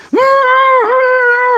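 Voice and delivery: long high-pitched loud wail; imitated bear howl. Storyteller imitating the scalded bear on the hill, high wail. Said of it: "then I hear it again".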